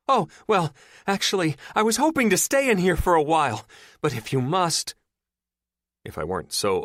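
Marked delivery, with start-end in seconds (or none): 0.1-4.9 high, loud, dry sarcastic shout; mock her rescuer, deadpan but shouting; trapped woman answers a dumb question with sarcasm. A narrator's reading. Sarcastic reply from elevator.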